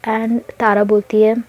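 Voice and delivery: plain reading voice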